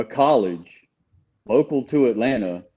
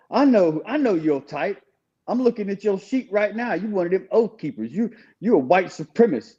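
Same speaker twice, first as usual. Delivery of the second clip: higher, half-played sneering chaplain; accusing chaplain; 7 semitones higher than usual. Chaplain scorning a new inmate, a lightly voiced high sneer.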